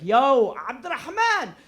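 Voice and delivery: yo, shouted across a field; calling out. Retold or imitated. Calling brother across the field, loud hail. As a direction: call out to your brother far off.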